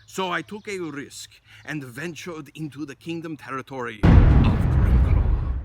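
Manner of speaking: ordinary delivery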